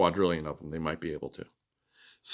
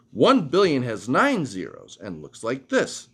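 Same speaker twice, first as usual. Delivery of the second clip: ordinary delivery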